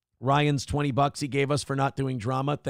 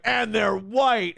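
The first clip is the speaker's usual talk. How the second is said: drawn-out, slow accusing line; slow gotcha; 5 semitones higher, much slower than usual. Retold or imitated. Critics' gotcha line mocked by the host, slow and drawn out. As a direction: say it slowly like a gotcha. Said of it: "And then they're like".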